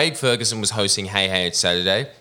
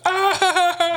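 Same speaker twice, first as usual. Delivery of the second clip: high laughing crowd groan; a big mock audience groan; imitating the studio audience. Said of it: "everyone would go".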